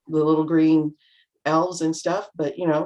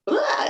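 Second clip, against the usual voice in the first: collapsing blah. Retold or imitated. Speaker recalling going home after work, high collapsing blah. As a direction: let it all out in one high blah. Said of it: "go home and go".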